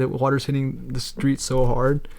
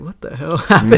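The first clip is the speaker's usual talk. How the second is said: laughing; 3 semitones higher, 5 dB louder than usual. Retold or imitated. Retelling own reaction laughing.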